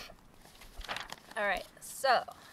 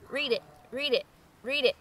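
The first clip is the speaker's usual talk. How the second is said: frog croak. Acted. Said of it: "for the frog to say".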